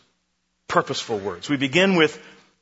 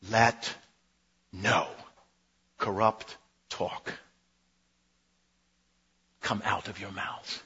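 a grave, softer and lower final repetition; hushed solemn close; 7 semitones lower, 10 dB quieter than usual. Acted. Preacher repeats command, dropping low.

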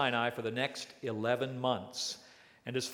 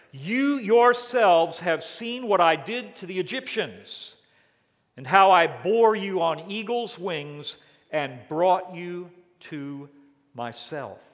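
God's words; 4 semitones higher, 7 dB louder than usual. Retold or imitated. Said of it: "he said".

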